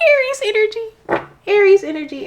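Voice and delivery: high-pitched excited exclamation; thrilled. Real, not acted. Thrilled at the card drawn.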